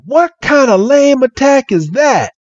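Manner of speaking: put-on high, scornful Southern accent; hammed-up Southern indignation; [name]. [name] mocking Red's attack, Southern accent. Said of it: "this Southern accent".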